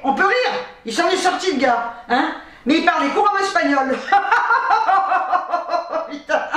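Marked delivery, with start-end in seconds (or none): 4.0-6.0 long, high peal of laughter; real uncontrolled hooting laugh; big laughing fit. Real, not acted. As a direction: burst out laughing and keep going. Cracks up at Spanish speaker.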